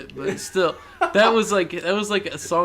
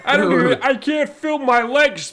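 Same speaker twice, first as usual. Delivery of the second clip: shouting; 9 semitones higher, 7 dB louder than usual. Retold or imitated. Shouting impression.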